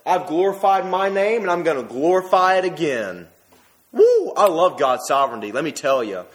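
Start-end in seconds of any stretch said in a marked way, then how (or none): 0.1-3.3 proclaimed loud and high like God. Acted. God answering his son, proclaimed loud. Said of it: "and God, I imagine, says". 3.9-4.3 high excited woo; joyful whoop. Real, not acted. Preacher whooping at his congregation, high and loud. Preacher exults over God's sovereignty.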